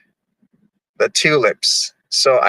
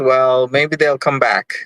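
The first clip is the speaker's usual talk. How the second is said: usual speaking voice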